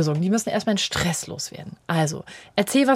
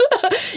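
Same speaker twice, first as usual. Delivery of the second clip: short laugh; 13 semitones higher than usual. Real, not acted. Laugh after mock yell.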